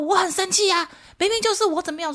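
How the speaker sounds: put-on high whiny child voice; imitating her angry son. Son complaining to mom about the soap, high childish whine. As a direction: whine it high like a cross six-year-old. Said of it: "他说".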